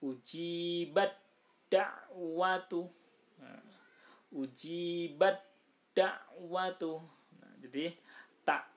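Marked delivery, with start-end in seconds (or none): 0.0-2.9 "Ujibat da'watu" chanted slow and higher, t turned into a doubled d; "Ujibat da'watu" in slow tajwid recitation, no t heard; merged ta into dal. Shown as a demonstration. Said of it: "taknya dihilangkan, dalnya ditasdidkan". 4.3-7.0 "Ujibat da'watu" chanted slow and higher, t turned into a doubled d; "Ujibat da'watu" in slow tajwid recitation, no t heard; merged ta into dal. Shown as a demonstration. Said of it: "taknya dihilangkan".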